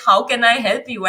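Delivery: ordinary talk, nothing marked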